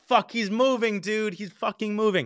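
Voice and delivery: high, urgent exclamation at normal volume; panicked alert. Retold or imitated. Bike owner seeing the tracker jump, retold panic. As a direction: relive the alarm of losing the target.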